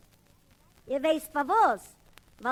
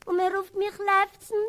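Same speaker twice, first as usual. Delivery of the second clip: higher, whining protest; plaintive outburst; 6 semitones higher than usual. Retold or imitated. Boy whines to the man that they call him Lefzen. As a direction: plead like a hurt boy. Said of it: "Ich sag mir".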